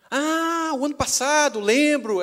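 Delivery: high, drawn "Ah" easing down as memory returns; acted old lady suddenly remembering; high-pitched dawning recognition; delighted recognition.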